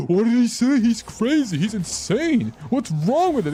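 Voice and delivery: high-pitched mock shock at someone; outraged chat reaction. Streamer mimics chat reacting to his grandmother claim, high mock outrage. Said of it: "you're already gonna be like".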